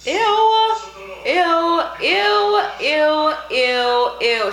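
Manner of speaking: high loud ews, fading down; loud disgusted ews. Real, not acted. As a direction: shriek ew over and over, then fade. Repeated ew at tongue.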